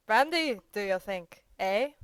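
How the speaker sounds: loud query. A narrator's reading. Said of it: "queried Petritsky".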